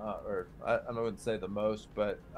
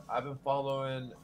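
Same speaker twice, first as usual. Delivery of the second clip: ordinary talk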